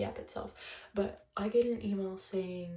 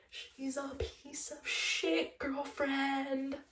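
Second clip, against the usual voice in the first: drawn-out, higher, snide put-down; snide dig; 5 semitones higher, much slower than usual. Real, not acted. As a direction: spell it out slowly to insult her. Slow, snide dig at the girlfriend.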